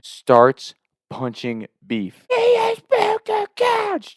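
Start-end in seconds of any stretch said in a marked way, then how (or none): 2.3-4.1 high, slow, shrieking sister voice; mock angry sister yell. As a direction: squeal it like the furious sister. Sister yelling while punching Beef.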